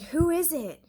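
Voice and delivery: high, loud and wary; calling through the door. Retold or imitated. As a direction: shout it at the door.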